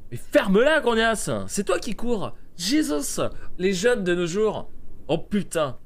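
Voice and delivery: high, loud mock-outraged scolding; mock angry rant. Player scolding passer-by with a kid, mock angry rant. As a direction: lay into them with exaggerated indignation. Acted quarrel with a game character.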